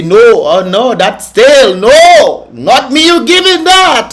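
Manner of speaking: high, loud, slow indignant refusal; outraged protest. Retold or imitated. Confronting the store staff, outraged high protest. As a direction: refuse the stale biscuit loudly. Retelling complaint about stale biscuit.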